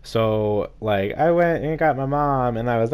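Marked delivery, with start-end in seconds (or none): none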